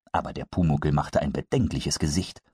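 usual speaking voice

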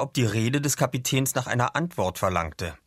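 usual speaking voice

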